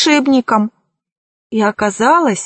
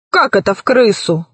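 usual speaking voice

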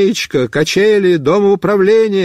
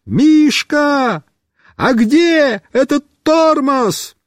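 high, slowed desperate call; shouting; 8 semitones higher, much slower than usual. Acted. Boy on the car shouts for help, desperate shouting. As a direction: yell the question while passing. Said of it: "Я кричу".

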